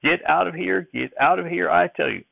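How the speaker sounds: high-pitched scolding as grandfather; angry shouting. A narrator's reading. Grandfather follows Uncle Levi to the barn floor, shouting. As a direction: chase him off with a high, cranky cry. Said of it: "followed him to the barn floor shouting".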